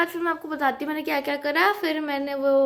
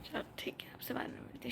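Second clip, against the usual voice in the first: soft unvoiced whisper; hushed goodbye whisper; 18 dB quieter, much faster than usual. Real, not acted. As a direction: whisper so no one wakes. Signing off quietly at night.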